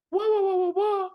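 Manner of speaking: squeaky high wah sounds; high imitation. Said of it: "And I think".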